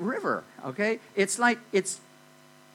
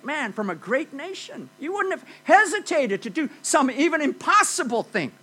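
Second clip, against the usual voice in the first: coaxing the master, much higher pitch; pleading servants; 7 semitones higher, 3 dB louder than usual. Retold or imitated. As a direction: gently persuade a proud master. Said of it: "they say".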